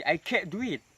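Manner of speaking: can't with the T stopped, not popped; held final t. Shown as a demonstration. Teacher giving the second version in a sentence, T held in can't. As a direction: swallow the T in can't, straight into "do". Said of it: "dengan cara menahan huruf T".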